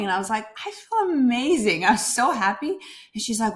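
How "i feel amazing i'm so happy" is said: higher, louder, beaming replay; retold joy. Telling a friend how happy she felt, high and loud. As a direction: gush it like you just woke up happy. Retelling her joyful text reply.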